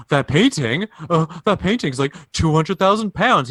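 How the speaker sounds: playing a startled client, voice climbing; mock disbelief. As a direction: raise the pitch, acting taken aback. Startled clients in retold negotiation.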